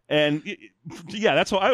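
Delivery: normal talking voice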